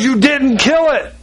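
high-pitched disbelief; acted-out exasperated husband; mock incredulous husband. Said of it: "He goes".

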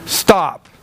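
ordinary delivery

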